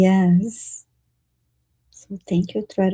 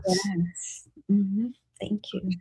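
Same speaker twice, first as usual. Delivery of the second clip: whispering; 6 dB quieter, much slower than usual. Real, not acted. Co-host thanks quietly.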